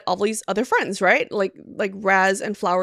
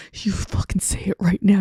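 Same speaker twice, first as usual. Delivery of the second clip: whispered demand; 3 semitones lower than usual. Retold or imitated. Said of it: "He's like".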